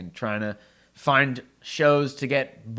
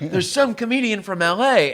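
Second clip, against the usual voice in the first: higher, mildly hammed-up excitement; mock excited announcing; 7 semitones higher, 4 dB louder than usual. Troupe passing word of a visiting stand-up, mock excitement. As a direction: pass on the big news, half-mocking.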